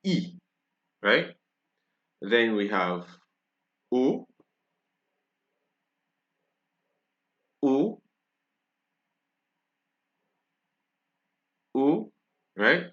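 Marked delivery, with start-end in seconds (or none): 0.0-0.4 E as a high "ee"; French I sound. Shown as a demonstration. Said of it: "gives the same pronunciation". 3.9-12.1 O as a pure, rounded "oh"; French O sound. Shown as a demonstration. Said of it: "kind of the same in French and English".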